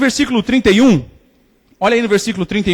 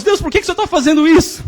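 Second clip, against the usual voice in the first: anguished plea; 7 semitones higher than usual. Acted. Suffering believer cries out to God.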